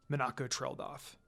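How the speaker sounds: ordinary delivery, nothing marked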